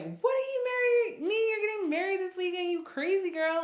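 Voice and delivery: high, loud imitation of a shocked mother; frantic mock-outraged mom; mom freaking out. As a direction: act out a mother's high-pitched disbelief. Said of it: "my mom starts freaking out".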